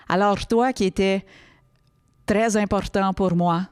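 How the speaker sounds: trilled R in "Alors", "très" and "important"; hammed-up rolled R in "Alors" and "pour". Shown as a demonstration. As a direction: roll every R, as in "très important pour moi". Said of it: "si tu le fais en roulant des airs".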